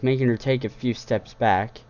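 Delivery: ordinary delivery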